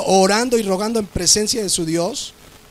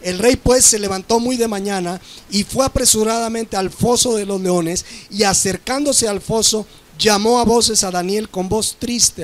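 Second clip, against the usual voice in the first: ordinary delivery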